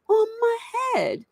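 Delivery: crying little son. Retold or imitated. Little son runs to dad after the dog game, high teary whimper. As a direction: whimper it like a small hurt child. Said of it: "And he's like".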